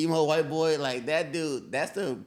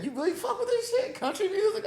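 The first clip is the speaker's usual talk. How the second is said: high, disbelieving squeal with a laugh; incredulous mock voice. Man mocking his girlfriend's country music, high and laughing. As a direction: squeak it out like you can't believe it. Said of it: "I be like".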